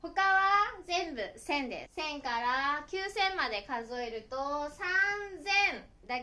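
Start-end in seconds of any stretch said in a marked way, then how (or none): 4.7-5.8 三千 read "san... zen", voiced z; sanzen. Shown as a demonstration. Teacher counting thousands for learners, san-zen with a z. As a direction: stretch 三, then say 千 as "zen". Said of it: "千というふうに読みます".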